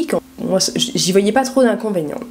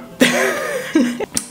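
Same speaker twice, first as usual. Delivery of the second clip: high giggle; 11 semitones higher than usual. Real, not acted. Laughing at her own story.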